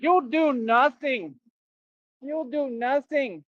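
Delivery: You'll do nothing stretched slow and high like a cocky fighter; Conor McGregor impression. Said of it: "Like Conor McGregor said".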